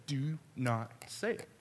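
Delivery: slow, low, quiet warning; stern warning. Retold or imitated. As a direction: warn her off slowly, voice held down. Warns mom through a teasing threat.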